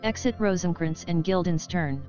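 normal talking voice

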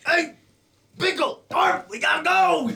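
high, loud goblin yelling to run; panicked goblin shout. Acted. Goblin calling his friends to run, high panicked shout. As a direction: shout for his buddies to flee.

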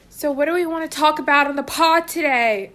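loud, fast and high; playful podcast yell. Real, not acted. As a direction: shout the question happily. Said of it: "I'm gonna stop yelling".

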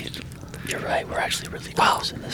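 hushed unvoiced whisper. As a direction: whisper so he won't hear. Hiding in closet, whispering.

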